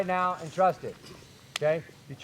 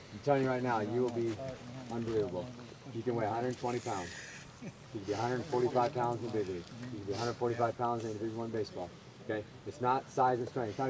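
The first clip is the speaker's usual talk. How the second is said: earnest pep talk; 5 semitones lower, 8 dB quieter, faster than usual. Real, not acted. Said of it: "coach gives earnest pep talk".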